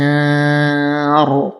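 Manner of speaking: الأنهار ending on a heavy ra; pausing recitation. Shown as a demonstration. Teacher shows the pause on the word. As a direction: stop on الأنهار with a thick ra. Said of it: "نقف على الراء بالتفخيم".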